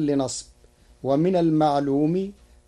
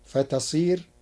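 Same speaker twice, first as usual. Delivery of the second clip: ordinary delivery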